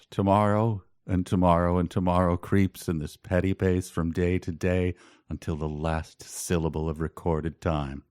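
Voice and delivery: low, soft Shakespeare recital; dramatic recitation. Acted. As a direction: intone it like a grave stage actor. Recites Macbeth as a joke.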